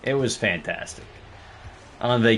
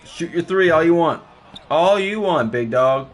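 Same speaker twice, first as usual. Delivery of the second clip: cocky trash talk; taunting; 5 semitones higher than usual. Real, not acted. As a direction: goad the opponent to shoot. Taunting the opponent.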